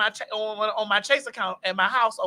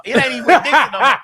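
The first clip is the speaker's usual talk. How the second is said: laughing; 6 dB louder, faster than usual. Real, not acted. Mocking the store's new name.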